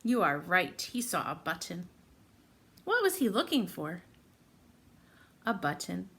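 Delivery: usual speaking voice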